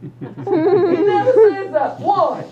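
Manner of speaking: slow, high and loud through laughter; amused disbelief; laughing. Real, not acted. Laughing at the idea.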